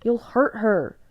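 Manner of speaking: usual speaking voice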